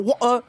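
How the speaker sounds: "water" broken into wa'uh, no T; mock British pronunciation. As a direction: drop the T and R of "water" with a catch in the throat. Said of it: "They pick one consonant per word to pronounce".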